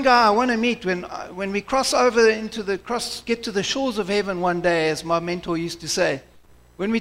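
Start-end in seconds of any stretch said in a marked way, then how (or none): none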